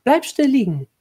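high, loud, alarmed command; urgent order. A narrator's reading. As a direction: urge the injured child to stay put. Woman orders injured boy. (dringend befohlen)

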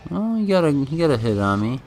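normal talking voice

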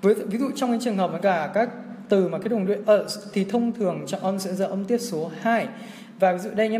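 ordinary talk, nothing marked

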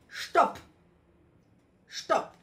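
a firm, loud shouted command; loud stop. Shown as a demonstration. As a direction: stop yourself out loud, sharply. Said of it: "Ganz laut".

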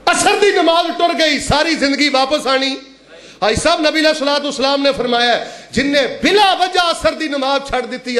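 high-pitched sermon warning; impassioned preaching. Real, not acted.

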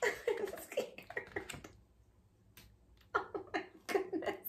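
hushed, breathy fake fright; whispered. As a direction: whisper it nervously.

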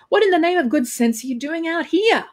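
high-pitched scolding disbelief; acted baffled outburst; incredulous. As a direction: demand an answer in high astonishment. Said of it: "followed by an incredulous noise".

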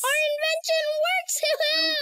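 high, fast cheer; jubilant. Acted. Child telling a friend the invention works, jubilant. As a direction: burst out celebrating.

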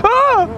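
short high shriek; high scared cry; real frightened scream. Real, not acted. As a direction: yelp in fear. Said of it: "for screaming".

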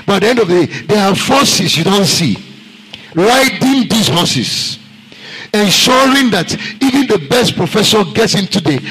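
building pulpit fervour; fiery preaching. Acted. Preacher builds to full force.

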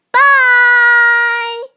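loud, high, bright farewell; cheerful bye. Real, not acted. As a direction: call out goodbye to the viewers. Cheerful sign-off to viewers.

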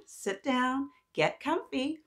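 ordinary delivery, nothing marked

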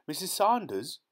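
high, loud excited call across the garden; excited shout. A narrator's reading. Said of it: "his voice shrill with excitement".

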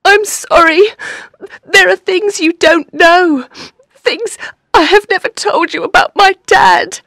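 high, tearful confession; distraught, high-pitched weeping; crying. Acted. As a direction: sob it out haltingly. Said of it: "because Lisa was crying".

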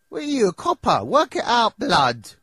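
high loud sneering jab; mocking scorn. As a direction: taunt him for asking. Mocking the player's question.

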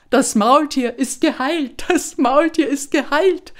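high, loud joyful cry; neighbours calling out. A narrator's reading. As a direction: shout the good news across the yard. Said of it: "riefen die Nachbarn".

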